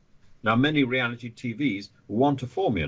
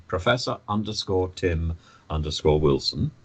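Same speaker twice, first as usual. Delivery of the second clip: ordinary talk, nothing marked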